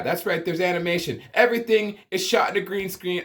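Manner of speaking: normal talking voice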